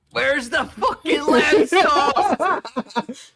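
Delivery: high, loud, stretched-out chef shout; mock yell. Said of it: "we yell out".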